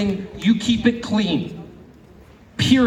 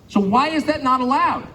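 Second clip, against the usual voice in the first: ordinary talk, nothing marked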